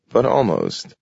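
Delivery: ordinary delivery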